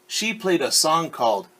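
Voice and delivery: clear, lively read-aloud voice; storyteller reading a picture book; story narration. A narrator's reading. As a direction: read it to a class of kids.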